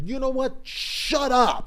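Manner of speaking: high, loud "Shut up" snapped in anger; retold angry snap. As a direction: snap it at him, half joking. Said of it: "I gave him a nasty look and said".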